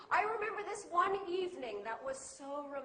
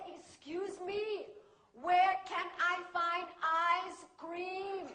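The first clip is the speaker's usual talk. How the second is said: student's deliberate local-English complaint; Chai Shen. Retold or imitated. Student telling teacher of London mishap, local accent. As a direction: recount it in broken local English. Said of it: "She said".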